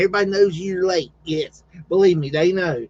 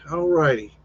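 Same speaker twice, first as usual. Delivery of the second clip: normal talking voice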